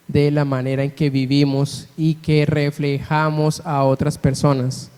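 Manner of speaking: usual speaking voice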